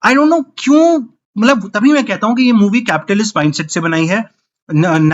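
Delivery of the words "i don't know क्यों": high, slow, baffled I don't know; exasperated. Real, not acted. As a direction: throw up your hands in disbelief. Exasperated rant about old songs. (हैरान और खीझा हुआ, ऊँची धीमी आवाज़)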